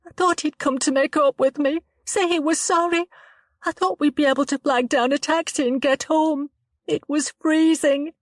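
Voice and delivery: put-on a frightened girl telling it haltingly; shaken young woman Chloe.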